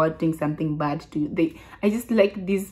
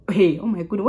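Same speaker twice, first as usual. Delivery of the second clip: normal talking voice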